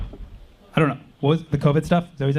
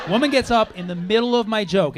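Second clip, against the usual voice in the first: ordinary delivery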